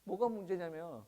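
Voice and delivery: ordinary talk, nothing marked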